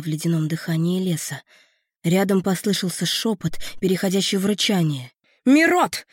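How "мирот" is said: high, loud call. A narrator's reading. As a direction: call him across the dark. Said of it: "позвала парня Лютая".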